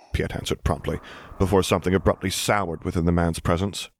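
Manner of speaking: ordinary delivery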